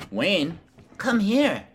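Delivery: high call to a puppy; dog voice. Real, not acted. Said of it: "We all have our dog voices".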